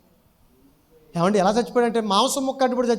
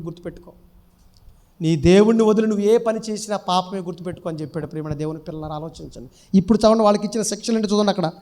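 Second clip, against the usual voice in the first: ordinary talk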